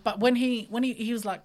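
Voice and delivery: usual speaking voice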